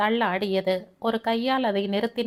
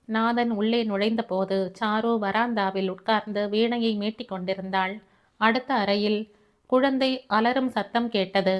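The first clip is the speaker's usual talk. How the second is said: plain reading voice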